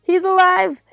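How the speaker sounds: high, loud shouted news; yelling back. A narrator's reading. Narrator yelling back from the window, high loud shout. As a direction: shout it across to the next building. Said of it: "yelled back".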